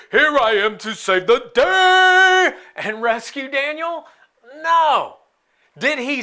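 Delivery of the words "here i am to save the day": higher, louder heroic boast; caped superhero. Pretend. God imagined as a caped hero swooping in, booming heroic. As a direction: announce it like a cartoon hero arriving. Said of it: "swoop in with a cape and say".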